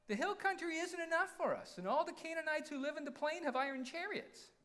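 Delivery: high-pitched mock whine; hammed-up whiny objection, held high. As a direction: whine it high, like a put-upon complainer. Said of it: "I'm gonna read it in a whiny voice".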